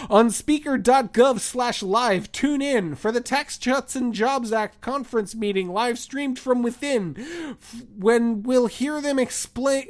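lilting holiday poem reading; poem recital. Acted. Reads parody poem aloud.

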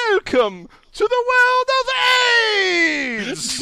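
huge, high, booming circus announcer; ringmaster. Acted. Host redoing the catchphrase on request, as a ringmaster. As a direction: belt it out like a ringmaster. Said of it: "like, a ringmaster approach".

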